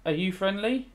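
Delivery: ordinary delivery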